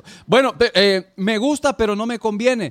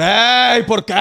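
sudden loud, raised "hey"; indignant protest; 3 semitones higher, 4 dB louder, much slower than usual. Acted. Driver objects to police asking for papers, indignant protest. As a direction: burst out in mock objection.

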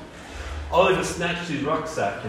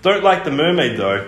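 louder booming put-down; the island booms its scorn at the crew; 5 semitones higher, 6 dB louder than usual. A narrator's reading. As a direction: boom it out with disdain. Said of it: "It boomed again".

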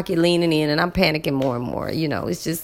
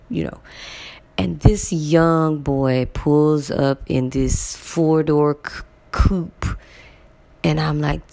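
ordinary talk

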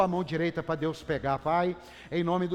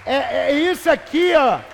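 much louder and higher, full-force yell; preaching shout; 10 semitones higher, 15 dB louder than usual. Real, not acted. Pastor hyping the congregation about God's love letter, shouting.

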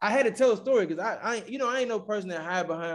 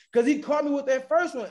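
ordinary delivery

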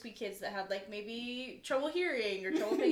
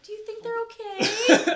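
mock kid voice; 10 semitones higher, 10 dB louder, slower than usual. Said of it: "eight-year-olds that are just like".

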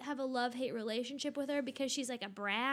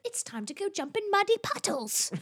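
put-on higher Peppa-style British child; imitated British accent; 7 semitones higher, 4 dB louder than usual. Daughter urging mom out into the rain, imitated British accent. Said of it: "She'll be like".

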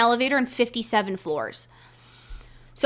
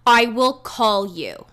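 slow, louder, spelled-out refusal; curt lobby man; 4 dB louder, much slower than usual. Retold or imitated. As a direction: talk down to her word by word. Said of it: "He's like".

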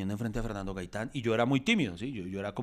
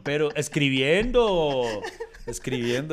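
laughing; 3 semitones higher, 7 dB louder, much slower than usual. Real, not acted. Laughing repeat of joke.

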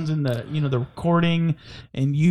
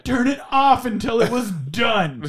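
higher, indignant mimicry; mock aggrieved reviewer; 4 semitones higher than usual. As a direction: play the outraged customer in a raised pitch. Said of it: "He's like, gives our podcast".

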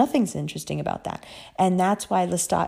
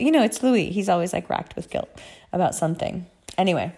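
ordinary talk, nothing marked

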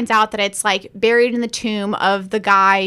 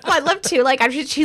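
ordinary talk, nothing marked

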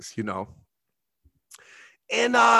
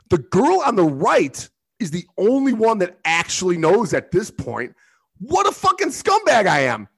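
ordinary talk

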